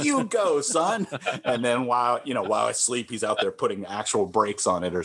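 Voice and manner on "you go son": high-pitched, hammed-up fatherly cheer; encouraging dad. Mentor played as a dad humoring his child, high cheering voice. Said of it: "And he's like".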